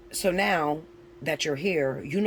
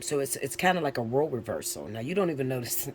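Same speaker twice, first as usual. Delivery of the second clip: ordinary talk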